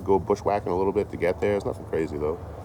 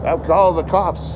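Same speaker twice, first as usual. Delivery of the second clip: neighbour threatening; 10 semitones higher, 5 dB louder, slower than usual. Retold or imitated. Neighbour voiced threatening the cops.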